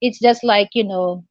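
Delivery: ordinary delivery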